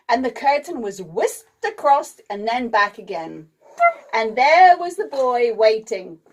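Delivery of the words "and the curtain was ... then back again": plain reading voice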